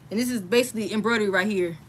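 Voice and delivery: usual speaking voice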